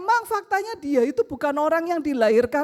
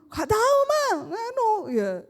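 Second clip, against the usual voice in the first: son's voice; 7 semitones higher than usual. Retold or imitated. Son answering mother in story.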